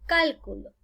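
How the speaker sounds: cálculo as CÁL-cu-lo; accent CÁL, let the rest drop; stress on first syllable. Shown as a demonstration. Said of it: "Y acá hay otro ejemplo".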